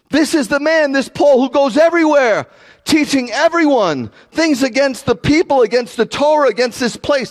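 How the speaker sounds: high, sustained crowd accusation; shouting. Retold or imitated. Accusers denouncing Paul to the crowd, acted shout. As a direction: denounce him at high pitch, acting the accuser. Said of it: "They're shouting".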